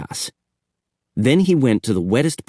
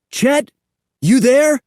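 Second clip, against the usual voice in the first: high, loud, worried calls; calling out; 6 dB louder than usual. Acted. Sid calling again for Chet, louder worried call. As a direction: keep calling, louder and more anxious. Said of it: "Sid called".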